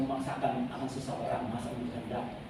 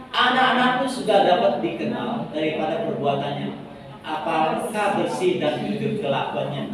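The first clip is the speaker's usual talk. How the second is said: louder scripture recital, slowing at the end; reading scripture aloud together; 6 dB louder than usual. Real, not acted. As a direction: read the verse out for all to follow. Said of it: "Tiga, dua, satu".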